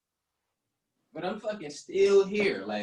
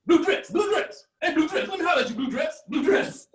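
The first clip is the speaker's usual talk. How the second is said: put-on high, insistent old-man catcall; old-timer's high-pitched come-on with a laugh in it; 11 semitones higher than usual. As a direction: holler after her, playfully aggressive. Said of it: "they're a little aggressive, but it's adorable".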